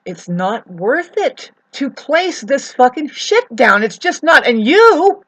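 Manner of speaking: high, loud, furious rant; angry outburst. Real, not acted. Venting at the neighbor's truckloads, shouting high and furious. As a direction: let the anger burst out. Ranting about the neighbor's dirt.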